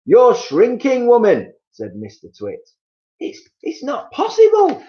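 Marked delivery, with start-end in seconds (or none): 0.1-1.5 much higher, louder, slow jeer; husband jeering at his shrinking wife; Mister Twit jeering. Acted. 3.2-4.8 far higher, stammering in fear; wife stammering at the news; frightened wife. Acted.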